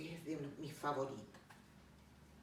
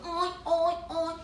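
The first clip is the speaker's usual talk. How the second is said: high, loud little oinks; piglets oinking; 11 semitones higher, 11 dB louder, much slower than usual. Acted. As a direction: oink like noisy piglets. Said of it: "Y los cerditos". (gruñidos agudos de cerditos)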